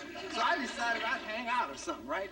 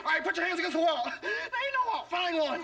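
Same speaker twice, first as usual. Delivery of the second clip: cop orders; 6 semitones higher than usual. Retold or imitated. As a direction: bark it like a bossy cop. Cop ordering him to the wall.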